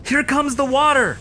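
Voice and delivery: high, loud rallying shout; battle cry. Acted. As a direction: charge in yelling. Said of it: "Tony's battle cry calling out".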